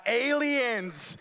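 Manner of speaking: startled shout of aliens; panicked cry. Retold or imitated. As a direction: blurt it in teenage panic. Panicked teen sees UFO.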